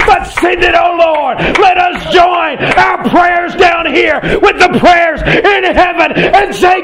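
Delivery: high, drawn-out revival cries; full-force preaching. Acted. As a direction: call out to God with long, pleading vowels. Preaches a climactic plea to God.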